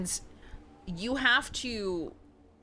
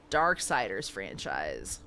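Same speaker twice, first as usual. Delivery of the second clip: deep slow voice; 14 semitones lower, 3 dB quieter, slower than usual. Pretend.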